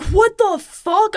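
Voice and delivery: high, drawn-out disbelief; retold stunned freak-out. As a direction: stretch it out in high-pitched shock. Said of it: "I'm, like, freaking out".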